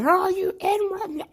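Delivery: high, drawn-out sneering taunt in a put-on voice; mock Klansman taunt. Imitating a Klansman taunting him, a high, slow sneer. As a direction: sneer it out slowly like a bigot mocking him. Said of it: "You know what they gonna say".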